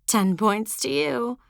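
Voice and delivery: put-on teasing teen girl. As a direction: savour the gloating. Rosie teasing.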